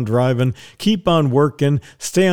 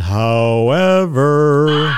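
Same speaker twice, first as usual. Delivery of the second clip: drawn-out shout; 4 dB louder, much slower than usual. Acted.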